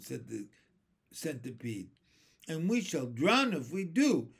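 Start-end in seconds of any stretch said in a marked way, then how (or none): 2.5-4.3 higher, louder retort; emphatic storybook protest; alarmed cry. A narrator's reading. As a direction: cry doom as the earthworm. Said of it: "cried the earthworm".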